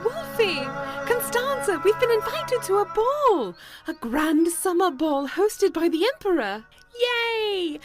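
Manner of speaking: excited. Real, not acted. Bringing the couple news of the ball, excited. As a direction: burst in with thrilling news.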